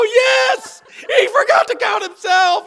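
loud, high, shouted through laughter; laughing shout. Retold or imitated. Cadet answering the officers through laughter: laughing shout. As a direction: yell it out while crying with laughter.

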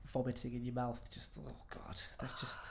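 unvoiced, soft aside; breathy whispered revulsion; whispered disgust. Real, not acted. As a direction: hush it like you can't bear it.

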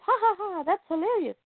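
high, cackly mock laugh; off-screen laughter. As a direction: laugh it out high-pitched, carrying into the words. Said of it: "so there's laughter off screen".

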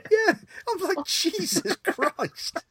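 laughing. Real, not acted. Laughing at film robbery scene.

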